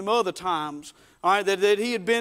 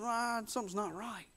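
soft, wary murmur to himself; uneasy murmur; 13 dB quieter than usual. Acted. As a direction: sense trouble and say it softly. Simon's uneasy reaction, acted.

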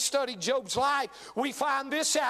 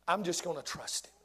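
soft low resolve; 6 semitones lower, 6 dB quieter than usual. Acted. End of Job quote.

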